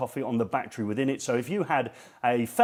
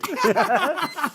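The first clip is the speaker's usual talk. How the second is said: laugh; 11 semitones higher, 3 dB louder than usual. Real, not acted. Joking about getting married.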